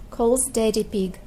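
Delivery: ordinary delivery, nothing marked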